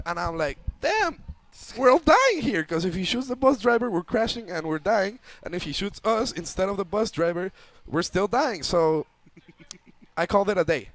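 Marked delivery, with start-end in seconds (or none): 0.8-8.6 high, loud start easing as it goes; replayed panicked reasoning, pitched well up; acted panic. Passenger realizing the driver may get everyone killed, mock panic.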